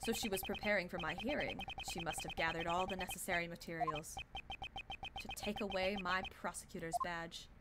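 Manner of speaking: low, soft inner monologue; brooding prosecutor. Acted. Prosecutor musing on losing his badge, low quiet monologue. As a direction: think it through quietly and low.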